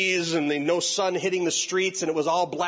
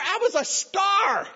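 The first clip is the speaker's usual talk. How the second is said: proud; slower than usual. Acted.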